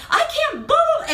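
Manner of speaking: high, slow wailed mock sob; mock blubbering. Imitating someone breaking down in tears. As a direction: blubber it in a hammed-up cry. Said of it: "or you're just like".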